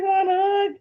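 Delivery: high, squeaky tentative question; retold squeaky invitation, rising at the end; awkward offer. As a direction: act out a nervous mom's hopeful offer.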